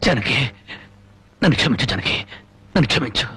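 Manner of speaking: low, broken plea for forgiveness; remorseful plea. Acted. Husband begs wife's forgiveness. (పశ్చాత్తాపంతో తక్కువ స్వరంలో వేడుకోలు)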